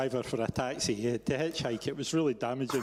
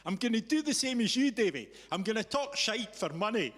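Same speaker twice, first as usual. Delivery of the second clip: high, louder cheeky imitation of Chris; Chris's cheeky punchline; 8 semitones higher, 4 dB louder than usual. Lightly voiced Chris teasing his old boss about talking shite. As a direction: deliver it like a cheeky lad landing a joke. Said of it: "And he goes".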